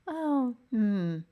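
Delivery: normal talking voice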